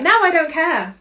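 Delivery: high, loud put-on indifference; mock not caring. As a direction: act out an exaggerated "whatever". Mock voice of false surrender.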